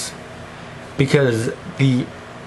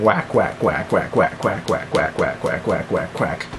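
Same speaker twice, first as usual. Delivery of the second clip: nonsense chant; 6 semitones lower, 5 dB quieter than usual. Pretend.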